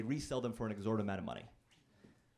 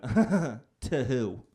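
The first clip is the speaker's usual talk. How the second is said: much louder scoffing to who, laughing; mocking laugh; 10 dB louder than usual. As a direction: laugh it off in disbelief. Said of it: "And she went".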